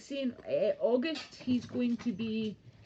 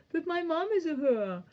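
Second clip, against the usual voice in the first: mock voice; 8 semitones higher, 4 dB louder than usual. Mocking friend's line.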